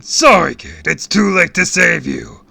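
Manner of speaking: loud, high mocking villain; shouted taunt. A narrator's reading. Villain about to hurl the kid, gloating shout. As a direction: bellow a false apology.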